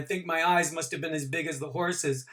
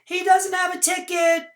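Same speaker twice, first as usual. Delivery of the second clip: put-on very high, loud child's screech; high, loud screech of an accusing child; 8 dB louder than usual. A narrator's reading. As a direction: screech it like a tattling kid. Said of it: "a child was screeching".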